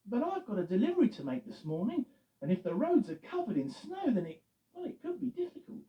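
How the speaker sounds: mildly worried Eddie voice; slightly concerned. Acted. Eddie explaining his delivery to Loretta, mildly worried. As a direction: fret a little about the roads. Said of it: "slightly concerned".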